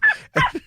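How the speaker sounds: high squeal of a laugh; stifled laugh escaping; burst of laughter. Real, not acted. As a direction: laugh breaking out helplessly. Can't hold back laughing.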